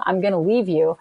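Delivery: ordinary talk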